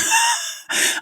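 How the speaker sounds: sudden high-pitched laugh; real high laugh after a joke; burst of laughter. Real, not acted. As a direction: break into a high laugh at your own joke. Laughs at her own joke.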